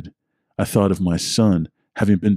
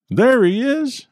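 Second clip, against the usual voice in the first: calling out; slower than usual. Acted. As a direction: shout out on spotting him. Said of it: "I heard Henry call out".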